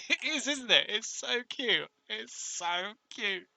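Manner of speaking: high-pitched cooing delight; gushing. Real, not acted. Gushing over something cute.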